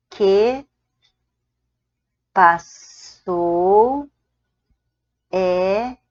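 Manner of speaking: each word drawn out with pauses; slow spaced-out teacher dictation. Shown as a demonstration. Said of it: "Se fosse assim".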